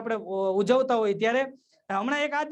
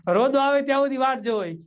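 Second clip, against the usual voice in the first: normal talking voice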